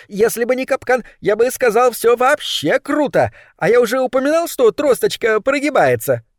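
high, squeaky little rat voice; trapped rat. A narrator's reading. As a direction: chatter on in a tiny high voice. Trapped rat answers boss. (высокий крысиный голосок)

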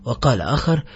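ordinary delivery

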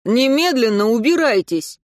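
loud angry bark; barked order. A narrator's reading. Anton angrily ordering Katya out, loud bark. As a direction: snap him out of the room. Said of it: "гаркнул".

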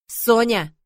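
loud, insistent repeat of her name; insistent call. Acted. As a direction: call her again, firmer. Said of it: "настойчиво повторил Вильберт".

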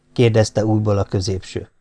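plain reading voice

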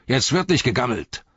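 high, forceful driver's command; called-out order. A narrator's reading. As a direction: chivvy the slackers along. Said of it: "rief er".

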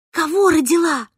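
shocked question, pitched high; stunned question. A narrator's reading. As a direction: ask as if you can't believe it. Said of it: "потрясённо спросила я".

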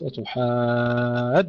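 slow "أتحاجوننا", alif pushed hard into doubled jim; clearly doubled jim. Shown as a demonstration. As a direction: drive the long alif of "أتحاجوننا" onto a pressed jim. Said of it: "حتى يظهر تشديد الجيم جيدا".

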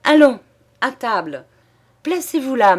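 hearty shout to the guests; shouting host. A narrator's reading. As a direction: boom it out to summon everyone to dinner. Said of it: "Alors le maître cria".

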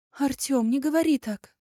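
high, loud desperate pleading. Acted. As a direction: beg him, hurt and panicked. Elya pleads.

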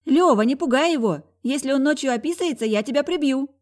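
loud high shout from afar; shouting from another room. A narrator's reading. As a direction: yell it through the bathroom door. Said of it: "прокричала из ванной Тая".

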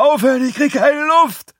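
very high, loud, choking-for-air yell; desperate high-pitched shouting; panicked scream. Acted. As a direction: scream as if suffocating. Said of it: "schrie er".

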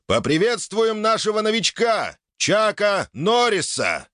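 high, drawn-out arena announcer; booming prize announcer. Acted. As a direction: boom it out to the stands. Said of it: "произносит он".

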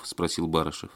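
ordinary delivery, nothing marked

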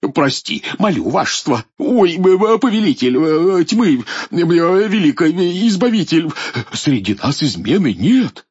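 high, loud, frightened stammering; stammering in fear. Acted. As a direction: grovel and stammer in panic. Воевода в страхе оправдывается перед идолом. (заикаясь от страха)

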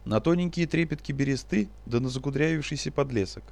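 ordinary delivery, nothing marked

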